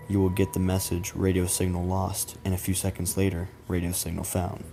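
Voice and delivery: ordinary delivery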